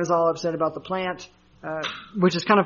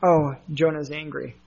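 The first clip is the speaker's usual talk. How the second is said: ordinary talk, nothing marked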